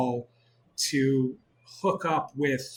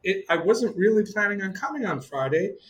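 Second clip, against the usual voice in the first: retold reluctant hedging; 7 semitones higher, 3 dB louder than usual. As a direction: stall politely, stretching the first words up high. Acting out his reluctant phone answer.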